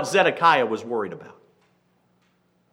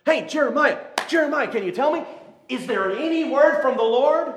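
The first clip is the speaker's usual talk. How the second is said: much higher mock-anxious voice; whiny king; 9 semitones higher than usual. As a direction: play the king as a nervous whiner. Preacher mimics anxious king.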